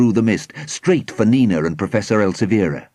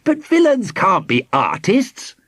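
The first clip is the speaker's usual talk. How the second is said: high, slow objection; disapproving; 10 semitones higher, slower than usual. A narrator's reading. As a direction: object with prim disbelief. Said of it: "Formby said disapprovingly".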